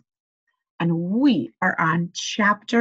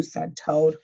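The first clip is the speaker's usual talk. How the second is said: ordinary delivery, nothing marked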